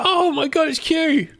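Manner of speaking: high, loud fan's exclamation; retold burst of delight; excited recognition. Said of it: "I was like".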